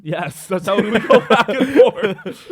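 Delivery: loud, slow, laughing through it. Real, not acted. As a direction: laugh out the answer. Laughing answer to a question.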